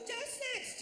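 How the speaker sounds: "Just next" squawked high as one mashed word; mimicked lazy "Just next", squeezed and high; slurred counter call. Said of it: "she was barely trying to speak".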